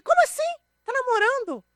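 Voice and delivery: very high, loud astonished squeal; stunned high-pitched "what?!"; shocked disbelief. Retold or imitated. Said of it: "Aí eu virei e falei assim".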